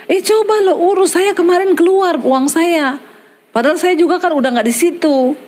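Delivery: lightly acted friend, pitched well up; retold high-pitched nudge to try it; eager urging. Friend urging him to claim money.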